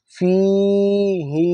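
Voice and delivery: ফিহি said very slowly, both vowels stretched long; long drawn vowel. Shown as a demonstration. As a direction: draw out ফিহি with a long even pull on each ই. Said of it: "একই রকম টান হবে". (ফিহি টেনে লম্বা করে পড়া)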